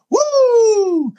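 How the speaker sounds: very high, sustained whoop; Ric Flair woo. Shown as a demonstration. Showing listeners what to shout in stores, whooping. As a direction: hold a piercing high wrestler's woo. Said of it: "Ric Flair's thing is he goes".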